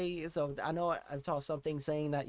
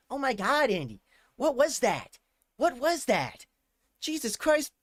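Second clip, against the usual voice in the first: higher, louder; reeling after the ending, louder; stunned exclamations; 8 semitones higher, 6 dB louder than usual. Real, not acted. A reactor stunned by the video, exclaiming loudly.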